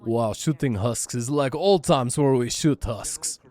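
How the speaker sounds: slow mock Russian accent; reminiscing in a hammy Russian accent. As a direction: put on a Russian accent, drawn out. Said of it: "I don't know why I'm doing a Russian accent either".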